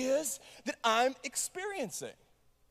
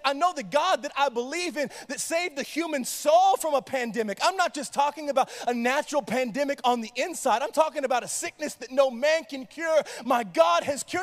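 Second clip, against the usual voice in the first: impassioned, raised preaching; 3 semitones higher, 4 dB louder than usual. Acted. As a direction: preach it with rising fervour. Preaching at full force.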